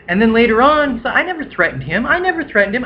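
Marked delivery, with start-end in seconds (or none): 1.1-2.0 higher, whining imitation of the roommate; a hint of a sulky liar; mock whiny denial. Narrator imitating roommate denying the threat, whiny. 2.0-2.8 much higher, rising mock whine with stress on never; mock shrill denial. Narrator mimicking roommate repeating denial, higher mock whine.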